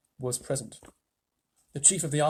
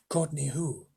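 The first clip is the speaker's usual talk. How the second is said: whispered Courtney who; spy whispering a reply; 4 dB quieter, slower than usual. A narrator's reading. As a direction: breathe it out secretly. Chief spy answers knock-knock.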